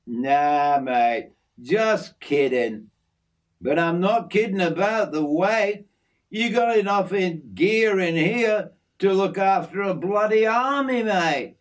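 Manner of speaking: easygoing outback mate showing off his aviary; hint of a broad Australian working man; Aussie bloke Jim. Jim teases.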